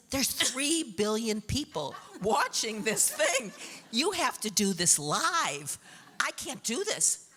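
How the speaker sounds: half-laughing panic about the live show; panicky. Retold or imitated. To husband about speaking live, panicked and laughing.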